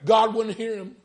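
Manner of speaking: high, loud preaching cry; preaching at full force. Acted. Preacher retelling the story, full-force preaching.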